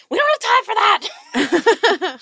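high, loud and slow, fed up; exasperated shout. Acted. Zamira shouting at Shar to put the book away, high and loud. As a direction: yell at her to drop it.

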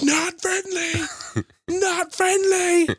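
meme sound voice. Retold or imitated. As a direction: stretch it like the dog-video meme.

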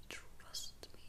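barely audible whisper; fading echo. Retold or imitated. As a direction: let the echo die away to a whisper. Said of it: "her friend's voice ringing in her ears".

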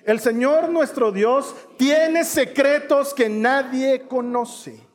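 high, measured scripture recitation; leading group reading. Shown as a demonstration. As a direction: lead everyone reading aloud, drawn out. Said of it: "Ayúdame a leerlo desde el principio".

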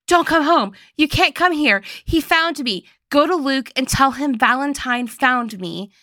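high, panicked retold phone plea; urgent warning. Mom calling Clary from the bathroom, urgent and high. Mom's frantic warning call acted out.

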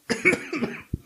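sudden sputtering cough on a drink; choking cough. Real, not acted. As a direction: cough as the gin goes down wrong. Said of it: "sorry, choking on my gin".

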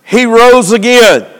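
emphatic sermon delivery in measured phrases; preaching. Real, not acted.

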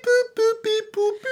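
high beep boop tones; beeping dial tones. Retold or imitated. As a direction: chirp like phone keypad tones. Imitating phone dial tones.

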